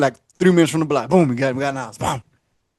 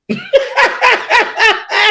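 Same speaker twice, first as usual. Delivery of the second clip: high laugh. Real, not acted. Laughing before admitting no job.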